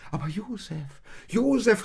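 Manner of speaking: high, loud eager call; excited calling. Acted. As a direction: call out to Josef in joy. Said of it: "rief Maria".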